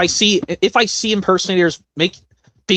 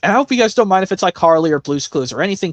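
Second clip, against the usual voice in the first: ordinary talk, nothing marked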